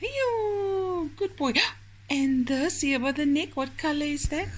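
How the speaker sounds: put-on high, sing-song praise for a child; talking to a toddler. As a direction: coo like to a little boy.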